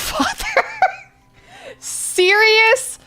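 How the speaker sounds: ordinary talk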